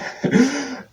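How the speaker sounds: high-pitched laugh at own stumble. Real, not acted. Laughs after stumbling on French.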